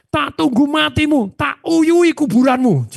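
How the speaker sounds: high, slow menacing threat; slow mock curse quoted high; retold threat. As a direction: spit out a grudge curse.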